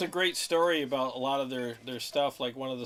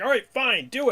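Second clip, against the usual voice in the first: loud, higher retold exasperation; exasperated giving in; 10 semitones higher, 9 dB louder than usual. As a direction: give in loudly, as the fed-up producer. Producer gives in to singer.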